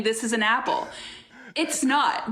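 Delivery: usual speaking voice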